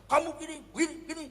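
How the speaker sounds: high, rapid-fire nagging; mock scolding. Husband scolding his silent wife, mock angry. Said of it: "Marahilah istri kita".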